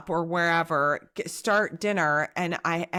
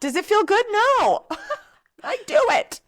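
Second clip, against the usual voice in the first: high laughing self-mockery, much louder; 6 dB louder than usual. Real, not acted. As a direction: laugh through the answer. Joking about the property tax.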